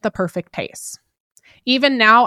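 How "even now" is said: ordinary talk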